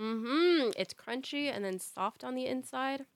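slow talk while chewing; mouth full. Real, not acted. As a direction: speak through a crunchy bite. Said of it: "hear the crunch on the microphone".

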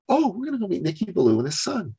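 high, quick imitated mom reply; mother to child. Mother telling her little son who they'll meet, lightly imitated.

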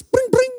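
imitated phone alert, two ringing notes; mock ringtone "bring, bring"; text message chime. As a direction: mimic a text message ping with the voice. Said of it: "my attempt at a text message sound".

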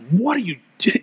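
mock-exasperated parent's demand; frustrated parent. God imagined as an annoyed parent, frustrated. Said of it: "like that frustrated parent".

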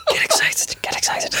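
hushed eager whisper; whispered egging on. Acted. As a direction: urge him on under your breath.

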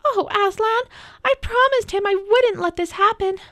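put-on high, pleading child's voice; distressed Lucy. A narrator's reading. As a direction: lament it high and urgently to the lion. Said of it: "cried Lucy".

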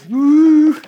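loud high "woo"; excited whoop. Real, not acted. Preacher whoops before reading on to church, excited. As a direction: let out a sudden high whoop.

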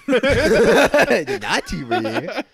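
high, loud, slow laughing cry; laughing hard. Real, not acted. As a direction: howl it out laughing. Laughing outburst at joke.

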